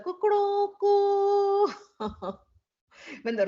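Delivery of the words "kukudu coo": high crowing cock call; rooster crow imitation. Storyteller imitates the rooster waking the girls, high crowing. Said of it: "they heard the rooster".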